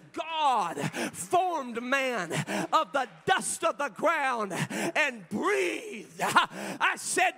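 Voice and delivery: slow, loud, high and drawn out; full-force preaching. Real, not acted. Pastor to the congregation, preaching slow and loud. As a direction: stretch every word like a thunderous sermon.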